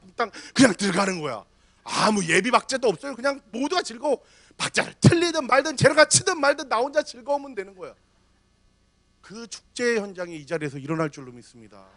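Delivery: usual speaking voice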